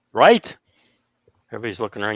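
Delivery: ordinary talk, nothing marked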